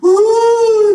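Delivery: high loud wailing cry; mock crying wail. Sister crying on the phone, high wailing sob. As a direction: imitate a sister bursting into tears. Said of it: "empieza a llorar la hermana".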